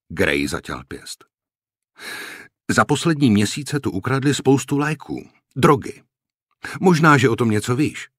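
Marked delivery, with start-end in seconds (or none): 2.7-8.1 high-pitched, pressing accusation; suspicious challenge, pitched up throughout; pointed accusation. A narrator's reading. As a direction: accuse him, voice raised and insistent. Officer accuses corporal of stealing drugs.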